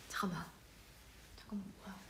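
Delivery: unvoiced muttered whisper; whisper to self. Real, not acted. Stuck on the test, a whisper to herself. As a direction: puzzle it out under the breath.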